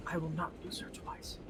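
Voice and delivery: hushed, breathless muttering to herself; the old lady mutters to herself, whispered; muttered whisper. Acted. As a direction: whisper it like a secret vow. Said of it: "you hear her muttering things like".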